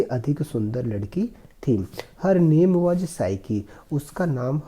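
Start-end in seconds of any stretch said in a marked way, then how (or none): 2.2-3.6 slow, careful reading of the English passage; slow reading aloud. Shown as a demonstration. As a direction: read each word slowly for the class. Teacher reads story slowly. (धीमी आवाज़ में अंग्रेज़ी पढ़ना)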